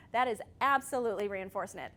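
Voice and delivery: ordinary delivery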